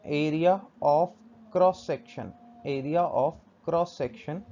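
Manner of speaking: very slow, spaced-out repetition; stretched words with pauses for writing; slow dictation. Shown as a demonstration. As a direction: dictate each word slowly and firmly. Teacher repeats term while writing.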